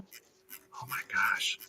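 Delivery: slow, unvoiced whisper; imitated exasperated whisper. Husband imitating wife hearing him eat Doritos, dismayed whisper. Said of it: "and she is like".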